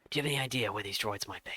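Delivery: quick, put-on Australian accent. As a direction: play a trooper with an Australian accent. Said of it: "I'll do my Australian accent".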